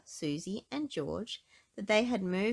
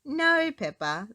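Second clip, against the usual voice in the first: laughing; 8 semitones higher, 7 dB louder, slower than usual. A narrator's reading. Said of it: "laughed Mummy Pig".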